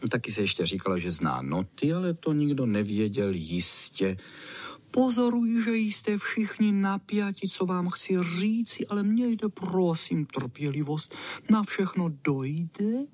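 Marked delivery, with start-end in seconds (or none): 4.9-13.1 high, squeaky little dog voice; squeaky chow chow. A narrator's reading. Chow chow addressing the expectant dogs, high squeal. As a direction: pipe it up high like a self-important dog. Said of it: "Zapištěl, eh, vysoko ten pes Čau Čau".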